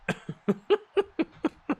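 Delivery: high giddy laughter; delighted laugh. Real, not acted. Streamer laughing at his team's early goal, giddy high laugh.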